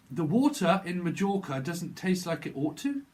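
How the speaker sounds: mock-posh English accent with careful words; proper English pronunciation.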